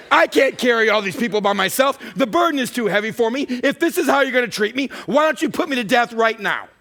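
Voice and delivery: exasperated outburst, high and loud; angry at God. Acted. Moses tells God the burden is too heavy, angry protest. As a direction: protest at the end of your rope. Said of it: "show you how to be angry at God".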